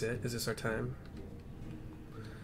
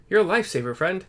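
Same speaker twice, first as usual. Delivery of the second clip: a cheerful quest-giver, louder at the start; friendly NPC voice; 4 dB louder than usual. Acted. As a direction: greet the hero as a grateful friend.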